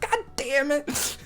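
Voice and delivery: mock kid grumble. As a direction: drag it out like a sulky kid.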